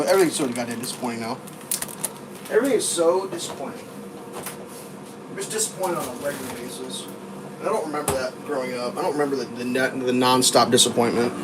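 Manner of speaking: low, soft, deflated; real dejection. Real, not acted. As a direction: let the disappointment sink the voice. Voices weary disappointment with everything.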